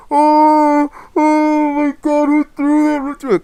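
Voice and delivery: mock the bully wailing after the hit; mocking crybaby. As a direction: imitate the bully as a whiny crybaby, high-pitched. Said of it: "Crying like a little bitch".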